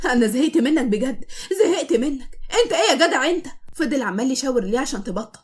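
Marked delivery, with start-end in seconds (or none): 0.1-3.5 laughing. Acted. Wife soaks her husband with the hose, laughing. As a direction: talk through laughter. Soaking him while laughing.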